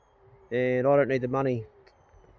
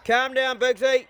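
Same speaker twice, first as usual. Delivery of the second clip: calm down Bigsy pitched up high; raised call to dog; 8 semitones higher, slower than usual. Real, not acted. To the overexcited dog Bigsy, a high raised call.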